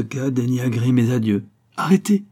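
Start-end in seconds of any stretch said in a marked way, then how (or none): 1.8-2.2 high, loud desperate cry to stop him; frantic cry. A narrator's reading. Aurélie frantically stopping Raoul from leaving, loud cry. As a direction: cry out in panic to halt him. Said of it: "S'écria Aurélie éperdue".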